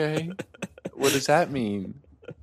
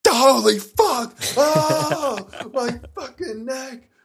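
high screaming yell of pain; 11 semitones higher, 4 dB louder, slower than usual. Acted. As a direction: shriek as if bitten. Bitten on the neck.

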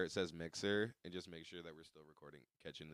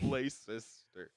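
mock Italian echo; 3 semitones higher, 5 dB louder, much slower than usual. Echoing the Italian bit.

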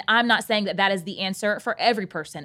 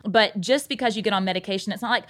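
usual speaking voice

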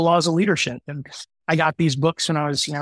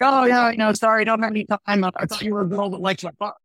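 higher-pitched mock British man on the phone; imitated British accent; 5 semitones higher than usual. British car owner brushing off a caller, imitated in a higher voice. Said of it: "a guy with a British accent".

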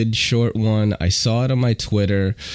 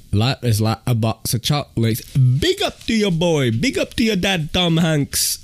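Forrest Gump line in hammed-up Jamaican patois, climbing higher; half-joking patois toast, pitched up; mock Jamaican patois; 8 semitones higher than usual. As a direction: play Tom Hanks' son doing Forrest Gump in patois. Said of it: "It's like".

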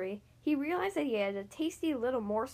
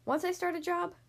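pitched up, mildly firm; 7 semitones higher than usual. A narrator's reading. Dentist to his wife, mildly firm. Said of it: "said the dentist firmly".

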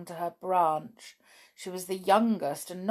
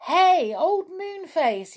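high, loud storybook holler; drawn-out hail, pitched way up; shout; 11 semitones higher, 7 dB louder, slower than usual. A narrator's reading. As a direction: call through a shut door. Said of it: "he shouted".

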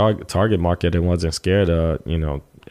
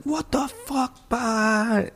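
stunned disbelief; 5 dB quieter, much slower than usual. Retold or imitated. Friend stunned he's in Vermont.

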